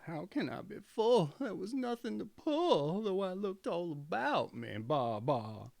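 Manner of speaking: high, bleating goat voice, sly and sulky; a cunning goat whining that she's starving. A narrator's reading. The goat's bleating complaint.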